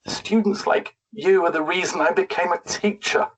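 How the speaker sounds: lightly voiced teacher close to tears; mildly wobbly teary voice. A narrator's reading. Reading the teacher's line to her pupils, mildly teary. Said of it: "her voice was all squishy and wobbly and full of water".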